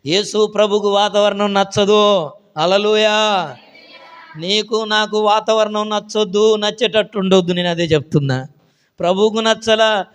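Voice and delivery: a touch of loud preaching. Acted. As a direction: exhort the flock firmly.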